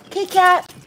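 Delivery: very high call to Kit Kat; sweet high pet call. Real, not acted. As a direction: coo the cat's name up high. Calling cat sweetly.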